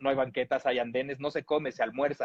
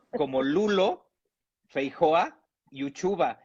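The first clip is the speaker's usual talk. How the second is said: ordinary delivery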